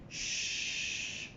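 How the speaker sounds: long, soft hushing "sh"; sustained voiceless "sh" on its own; drawn-out SH. Shown as a demonstration. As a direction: hold "sh" as one long steady hiss. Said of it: "Now, if I say, for example".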